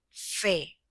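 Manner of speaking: fe said with extra force; the stressed fe of café, punched; stressed syllable. Shown as a demonstration. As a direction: hit the syllable fe hard. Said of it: "donde hacemos mayor fuerza de voz".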